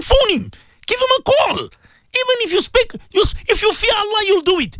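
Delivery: high, impassioned call to the listeners; fervent preaching. Acted. Radio preacher urging listeners to phone those they lied about, impassioned and high. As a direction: plead with them to make amends, voice lifted high. Urges listeners at full force.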